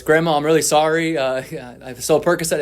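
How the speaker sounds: loud, high blurted apology to a deaf grandma; screamed confession. Retold or imitated. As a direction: shout it so a half-deaf grandmother can hear. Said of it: "and I screamed it at her".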